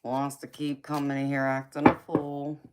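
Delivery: usual speaking voice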